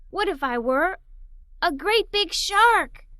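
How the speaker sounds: ordinary delivery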